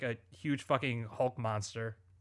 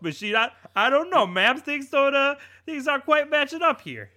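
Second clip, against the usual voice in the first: mock cop interrogating, higher pitch; 5 semitones higher, 3 dB louder than usual. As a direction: play a dim cop questioning.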